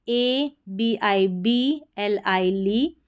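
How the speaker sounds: A B I B L I ली spelled out slowly, letter by letter; slow spelling. Shown as a demonstration. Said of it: "teacher spells letters slowly". (धीरे-धीरे spelling)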